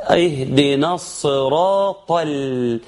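الصراط chanted into a light, clear "l"; thinned lam. Shown as a demonstration. Teacher tries to preserve light lam after الصراط. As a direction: slowly chant الصراط, keeping the following l thin. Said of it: "ترقيق اللام".

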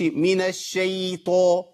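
الشيطان with a spread, tilted "shee" vowel; faulty imala, شي leaning toward ee; over-wide smile. Shown as a demonstration. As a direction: grin too widely on the slow شي of الشيطان. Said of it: "دي ابتسامه كبيره قوي".

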